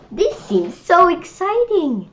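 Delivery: high, slow, gushing delight; excited. Acted. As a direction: sell the excitement to the kids. Opening the storybook.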